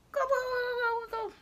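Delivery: high, quick gobbling; turkey gobble. Retold or imitated. Reading to a toddler, high mock gobble. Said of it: "What sound does her baby make?".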